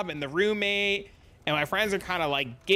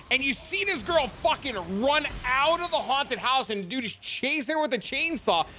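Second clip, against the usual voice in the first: ordinary talk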